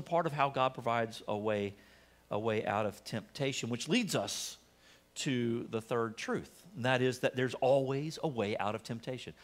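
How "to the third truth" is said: usual speaking voice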